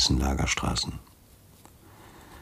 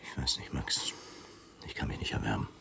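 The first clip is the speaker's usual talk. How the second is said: hushed, reluctant whisper, much softer; whispered doubt; 5 semitones lower, 14 dB quieter than usual. Acted. Commandant whispers his doubts about the plan to his friend Max. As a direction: breathe the refusal, almost no voice.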